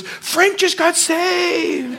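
very high, drawn-out joyful cry; imitated excited shout. Old coworker announcing a friend's salvation, imitated excited shout. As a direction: stretch the news out high, as if overjoyed.

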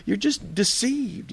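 mock Terry brushing it off; scoffing Terry. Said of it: "Terry goes".

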